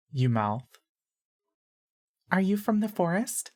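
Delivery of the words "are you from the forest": put-on much higher little-girl voice; high-pitched girl. A narrator's reading. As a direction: ask it in a high, girlish pitch. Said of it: "and high-pitched".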